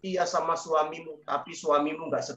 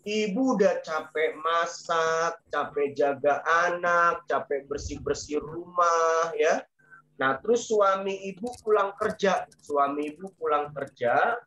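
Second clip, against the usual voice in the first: ordinary talk, nothing marked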